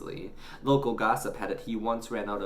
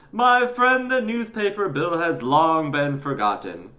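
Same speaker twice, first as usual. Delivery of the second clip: newsagent voice; 8 semitones higher, 8 dB louder than usual. A narrator's reading. Newsagent reassures.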